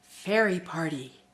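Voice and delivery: put-on soft, lilting invitation read to children; storybook invitation. Invitation to children for a fairy party, soft and lilting.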